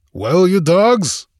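slow, high, loud roar. A narrator's reading. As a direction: roar at the pirate crew. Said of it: "he roared".

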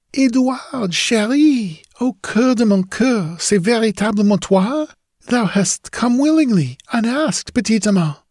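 put-on high pleading girl's voice; a yearning ghost girl, lilting; ghostly young woman. Ghost girl Julie speaks.

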